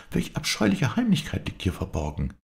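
high, appalled question; indignant exclamation. A narrator's reading. Viola demands the hidden secret, exclaiming indignantly. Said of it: "rief Viola".

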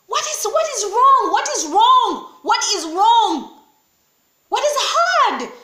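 loud demanding question; preaching at full force. Acted. Preacher demanding what is wrong, loud and forceful. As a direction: demand an answer from them.

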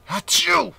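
big high acted sneeze; elephant's trunk stings, a loud sneeze. A narrator's reading.

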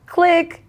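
high, loud spoken click sound; mock hang-up click. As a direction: snap out the hang-up noise. Aunt hangs up the phone.

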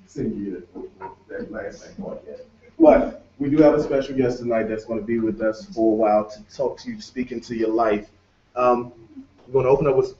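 usual speaking voice

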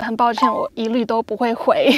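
ordinary talk

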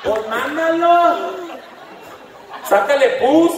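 high, very slow mocking drawl; Valley buddy teasing. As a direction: stretch out the insult like a taunting friend. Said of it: "your camaradas right away is gonna go".

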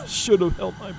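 coughing and choking through the words; choking on fear toxin, barely getting the words out; choking on gas. Acted. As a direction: fight out each word between harsh coughs.

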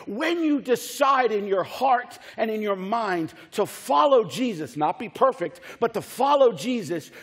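urgent pulpit exhortation; impassioned preaching. Real, not acted. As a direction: build wave on wave, punching key words. Preaches at full force.

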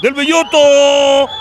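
long loud high goal cry; goal scream. Real, not acted. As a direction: hold the goal shout. Goal call. (grito de gol largo)

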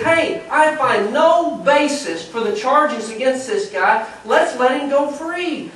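loud, high-pitched appeal to the people; Pilate addressing crowd. Acted. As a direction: call it out slowly to a crowd.

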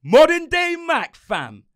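loud, punchy announcer hype; hype show intro. Acted. Host hyping listeners at the podcast opening, loud announcer voice. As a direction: pump up the crowd opening the podcast.

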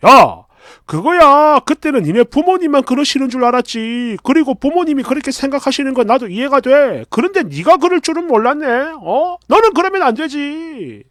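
acting out an annoyed boyfriend; irritated outburst. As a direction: lash out in accusation. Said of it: "막 이러면서 역정을 내는데".

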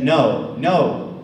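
loud, firm refusal of his no; young man insisting he'll help, loud and firm; insistent no. Retold or imitated. Young man refusing to let him carry canoe, loud no.

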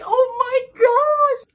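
high, loud, drawn-out wail; overwhelmed outcry. Retold or imitated. Reader reliving the sacrifice scene, loud overwhelmed outcry. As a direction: cry it out in shock, stretching the last word. Acts out her reaction to book.

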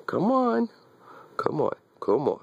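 high, sing-song coaxing to a dog; high pet-talk coaxing. Real, not acted. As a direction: call the dog up high and sweet.

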